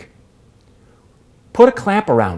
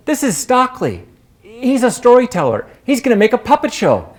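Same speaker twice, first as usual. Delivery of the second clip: lively raised voice, much higher pitched; jovial introduction; 8 semitones higher than usual. Retold or imitated. Dennis introducing the storyteller to his crew, jovially. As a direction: introduce him proudly with a grin. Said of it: "He said".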